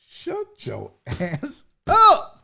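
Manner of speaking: very slow, word by word, laughing through it; drawn-out retort. Real, not acted. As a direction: stretch each word and kick up high on UP.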